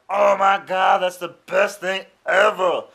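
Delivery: loud, high "Oh my God"; like the first bite blew his mind; amazed delight. Retold or imitated. Friend tries ranch pizza.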